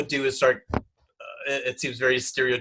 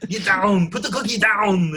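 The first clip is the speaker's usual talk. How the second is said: loud, higher Austrian action-hero bark; Schwarzenegger impression; 6 semitones higher, 5 dB louder than usual. Shown as a demonstration. Showing off a Schwarzenegger impression on request, loud hammed-up bark. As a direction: shout orders like an action movie star. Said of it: "I can do a pretty good Schwarzenegger".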